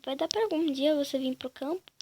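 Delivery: ordinary talk, nothing marked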